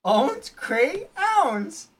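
"crayons" broken into high cray-owns; phonetic pronunciation. Shown as a demonstration. Told to read the word, "crayons" as cray-owns. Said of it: "I'm phonetically doing it so people can understand".

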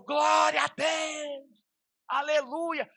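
high, loud, drawn-out church cry; imitated congregation shout. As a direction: mimic worshippers erupting in praise. Imita o povo bradando na igreja. (brado de glória imitando o povo)

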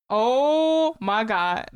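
long drawn-out "oh" of delight; excited. Real, not acted. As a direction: gush slowly over a favourite find. Thrilled to find a favourite song.

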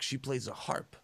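ordinary delivery

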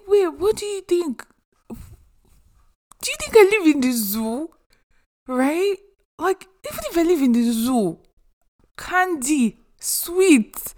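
high-pitched incredulous retort; indignant disbelief. Retold or imitated. Retelling indignant reaction to insulting question.

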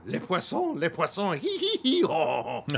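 high, loud French chef sing-song; Rene Auberjonois in Little Mermaid. Acted. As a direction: burst into a gleeful high cackling French character bit. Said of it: "Rene Auberjonois' bit in, um, in Little Mermaid".

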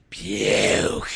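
drawn-out, throaty Vince-style growl; Vince impression. Co-host asked to say it like Vince, throaty impression. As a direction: strain the throat and stretch the single word. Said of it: "Why don't you just say it like Vince".

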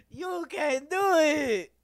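very high, loud, drawn-out catchphrase; Rob Schneider. Acted. Imitating his movie character for laughs, high hollered impression. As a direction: holler it slowly in a high cartoonish voice. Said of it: "fucking Rob Schneider".

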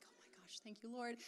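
stunned whisper, very soft; retold under-the-breath amazement; whispered thanks. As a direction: whisper a private prayer of relief. Hushed reaction to her crying.

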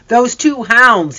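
ordinary delivery, nothing marked

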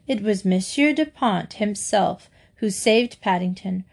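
ordinary delivery, nothing marked

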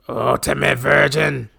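slow gravelly mock insult; Sergeant Slaughter. Podcaster inventing insults for the rival, slow gravelly impression. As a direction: drag it out in a wrestler's growl. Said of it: "Should have called him".

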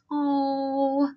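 soft melting "Oh" as if at a puppy; gentle fond sigh of "Oh"; tender aww. Pretend. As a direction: coo over something adorable. Reacting to something you love.

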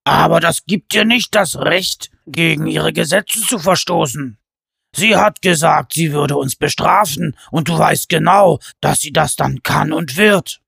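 loud giant scolding; a giant warning of the queen's punishment. A narrator's reading. Pen warns his brother.

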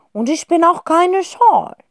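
high, loud, slow fox protest. Acted. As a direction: insist indignantly. (hohe, laute, empörte Fuchsstimme)

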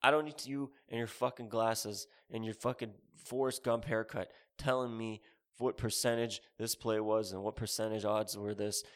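usual speaking voice

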